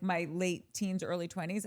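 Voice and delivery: ordinary talk, nothing marked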